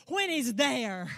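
loud, slow, punched out; hammered pulpit emphasis; emphatic. Acted. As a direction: drive each word home slowly. Repeats line, loud then quiet.

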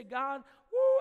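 loud burst of exasperation; mock exasperated woo. Parent praying over a disobedient child, exasperated woo. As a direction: blow off steam with a big woo. Frustrated prayer about child.

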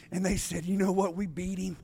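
very soft, low storytelling; hushed preaching. Real, not acted. Preacher voicing hell's celebration after the death, hushed and low. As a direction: keep it hushed before the boast.

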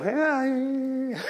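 long, high, wavering "ah"; hesitant groan. Acted. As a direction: draw out a doubtful whine before the jump. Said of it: "you would go".

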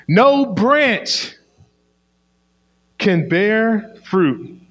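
slow, high, loud scripture proclamation; preaching at full force. Shown as a demonstration. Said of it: "I'm gonna say that again".

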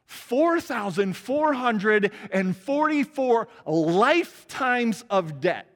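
four stressed each time, slow and high; emphatic repetition. Real, not acted. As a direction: hammer every four. Preacher repeating number for emphasis.

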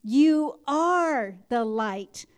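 very slow, solemn blessing; benediction. Acted. Pastor blessing standing congregation, slow and solemn. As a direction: pronounce the blessing slowly over them.

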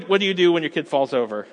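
normal talking voice

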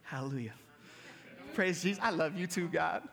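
low and soft fake cheerful thanks; mild mock delight; mock gushing praise. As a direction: pretend to welcome it. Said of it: "I'm not like".

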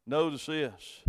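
ordinary talk

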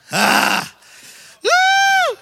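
loud joyful whoop, soaring high on woo; a preacher whooping with praise; excited shout. Real, not acted. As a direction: cry out in joy.

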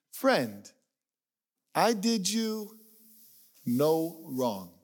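Friend, slow and measured, a pause before no wrong; master's reply read aloud. Acted. Preacher reading the master's reply to grumblers, slow and measured. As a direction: read the master's words firmly and slowly. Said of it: "listen to what the homeowner responds".

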